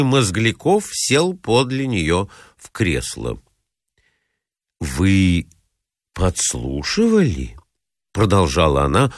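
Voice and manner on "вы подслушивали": reproachful. Acted. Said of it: "укоризненно глядя ему в лицо".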